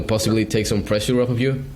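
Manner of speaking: ordinary delivery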